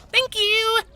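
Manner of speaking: very high-pitched little thanks; heroine's note voice. A narrator's reading. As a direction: chirp thanks in a tiny high voice. Heroine's written reply voiced in character.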